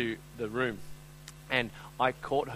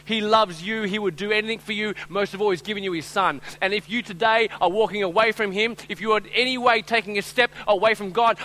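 high, loud impassioned sermon; raised, pleading preacher's voice; full-force preaching; 10 semitones higher, 10 dB louder than usual. Real, not acted. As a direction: preach it out with urgency.

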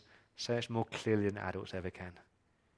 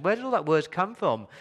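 rhetorical alarm; 8 semitones higher, 5 dB louder than usual. Real, not acted. Rhetorical question to congregation.

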